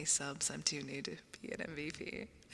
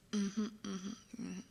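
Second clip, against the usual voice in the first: ordinary talk, nothing marked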